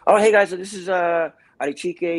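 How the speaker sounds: high, loud cheery introduction; host voice. Retold or imitated. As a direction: introduce him brightly. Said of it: "they're like".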